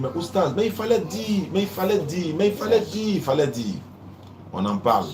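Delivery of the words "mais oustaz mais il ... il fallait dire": higher-pitched mock complaint, insistently repeated; imitating critics. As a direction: mimic critics nagging in a raised pitch. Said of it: "d'aucuns allaient dire".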